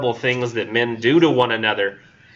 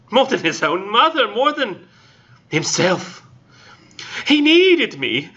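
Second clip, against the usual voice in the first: hammed-up grieving woman; 11 semitones higher than usual. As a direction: ham up a lovesick widow's lament. Voicing the Intended's grief.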